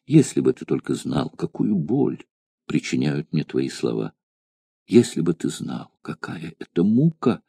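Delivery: half-whisper, breaking off between words; broken whisper. A narrator's reading. A trembling girl begs her suitor to stop, in a broken whisper. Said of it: "Она прерывисто шептала".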